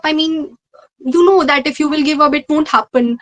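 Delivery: ordinary talk, nothing marked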